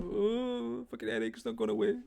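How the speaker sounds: long high groan sinking into a grumble; hammed-up headache moan; mock whinging. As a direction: whinge in exaggerated self-pity. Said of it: "just sitting there whinging".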